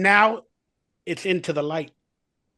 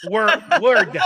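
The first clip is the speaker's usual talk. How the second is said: laughs through the corrected word; laughing; 6 dB louder than usual. Real, not acted. Guest laughing as she fixes her own word. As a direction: giggle over the slip.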